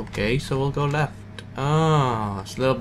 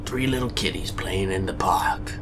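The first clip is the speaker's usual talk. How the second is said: spooky whisper of a nursery rhyme; eerie whispered rhyme; 4 semitones lower than usual. Pretend. Gamer reading the game's creepy rhyme in an eerie whisper. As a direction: whisper it like a haunted child's song.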